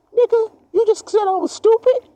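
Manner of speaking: high, indignant squawk of a fool; mock outraged high voice; offended fool. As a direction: play the insulted fool, outraged. Offended fool answering back.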